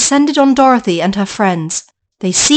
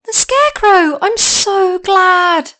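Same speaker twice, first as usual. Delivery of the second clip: put-on delighted girl; 8 semitones higher, slower than usual. Dorothy greets Scarecrow.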